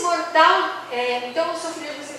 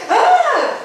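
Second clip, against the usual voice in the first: sharp high gasp of alarm; a parent's startled cry; 12 semitones higher, 6 dB louder than usual. Retold or imitated. Parents react to child's mask. (susto agudo dos pais)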